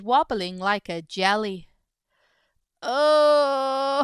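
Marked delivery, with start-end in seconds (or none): 2.8-4.0 a long pained groaning oh. Acted. Greedy iguana with a full belly, groaning. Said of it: "he groaned".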